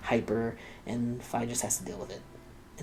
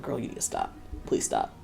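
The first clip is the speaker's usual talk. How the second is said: whispered plea. Retold or imitated. Fai voiced, begging Yoko.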